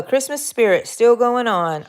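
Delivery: normal talking voice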